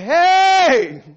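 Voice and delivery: high, loud shout of a spider; calling spider. Acted. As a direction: hail the fly from across the web. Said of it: "And the spider said".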